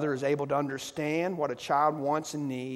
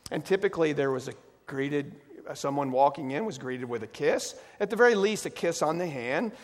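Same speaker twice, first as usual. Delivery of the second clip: normal talking voice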